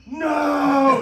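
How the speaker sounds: high, loud desperate no; retold anguished shout. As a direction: shout it like it's too late to stop. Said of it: "he goes".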